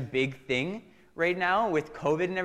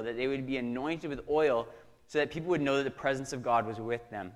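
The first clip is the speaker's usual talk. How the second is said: ordinary delivery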